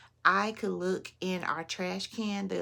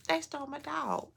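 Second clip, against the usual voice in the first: higher, in disbelief; incredulous; 8 semitones higher, 4 dB quieter than usual. Real, not acted. Telling the audience of the stolen dog, incredulous. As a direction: let the outrage lift the pitch.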